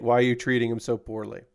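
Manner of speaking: ordinary delivery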